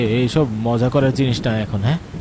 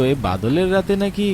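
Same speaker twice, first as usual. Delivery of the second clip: ordinary talk, nothing marked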